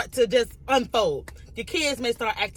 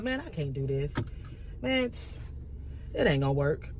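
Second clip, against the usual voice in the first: much lower and quieter, defeated; discouraged self-talk; 8 semitones lower, 8 dB quieter than usual. Acted. Speaker voices the listener giving up on a plan, discouraged. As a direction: mutter it low, giving up on yourself. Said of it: "You may even speak it out".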